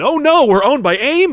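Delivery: high, loud, alarmed realization; hammed-up horrified reveal; mock dismay. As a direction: cry it out as a dreadful discovery. Acted dismayed discovery.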